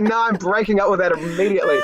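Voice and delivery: a giggly pretend breakup; laughing mock refusal. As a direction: joke the refusal while laughing. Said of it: "I was like".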